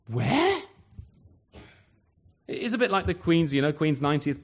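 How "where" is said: higher, rising mock bafflement; mock baffled where. First-century listener baffled by Nazareth, rising high. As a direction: toss it up high in disbelief. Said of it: "we'd probably go".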